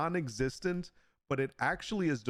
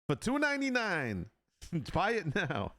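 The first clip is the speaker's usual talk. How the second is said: mock sales pitch; 7 semitones higher than usual. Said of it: "No, I'm just kidding".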